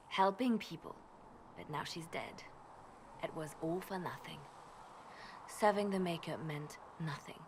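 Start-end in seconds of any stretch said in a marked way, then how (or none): 1.6-7.4 quiet grief. Acted. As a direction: voice sinks low and hushed, ending in a whisper. Grieving the Divine's death.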